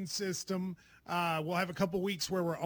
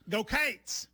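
Kentucky "Go Cats"; 3 semitones higher than usual. Shown as a demonstration. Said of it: "in Kentucky would go".

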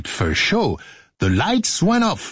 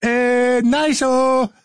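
playful; 9 semitones higher, much slower than usual. Pretend. Playful mock approval.

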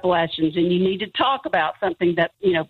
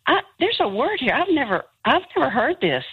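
raised-pitch retold puzzlement; mild bewilderment, pitched up; puzzled; 7 semitones higher than usual. Telling her brother about an unknown word, puzzled.

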